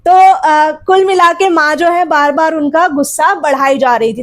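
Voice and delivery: usual speaking voice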